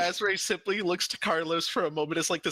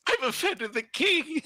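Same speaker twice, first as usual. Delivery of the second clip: squeaky falsetto mockery; 12 semitones higher, 3 dB louder than usual. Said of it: "player echoes the jester".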